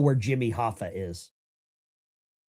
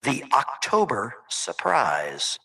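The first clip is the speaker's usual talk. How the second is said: a slow, mock-manly announcer line, softer; 6 dB quieter, slower than usual. Host hams up a manly voice for the caller. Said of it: "let me do it in my manly voice".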